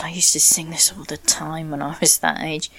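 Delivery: ordinary talk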